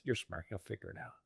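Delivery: soft, understated retold reply; offhand reply. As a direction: toss it off quietly as the wife. Voicing wife's offhand reply.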